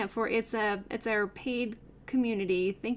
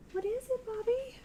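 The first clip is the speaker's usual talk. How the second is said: pet talk; 14 semitones higher than usual. Real, not acted. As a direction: coo up high at the pet. Talking to the pet.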